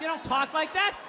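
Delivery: ordinary talk, nothing marked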